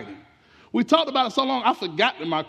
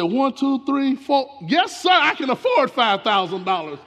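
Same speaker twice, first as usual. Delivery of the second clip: excited counting; 5 semitones higher, 5 dB louder than usual. Retold or imitated. Counting eagerly, can afford it.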